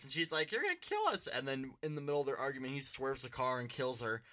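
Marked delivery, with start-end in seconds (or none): 0.5-1.3 very high imitated panic; high-pitched acted-out objection; mock alarmed protest. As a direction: squeal it as the frightened passenger. Retelling mom's side of argument.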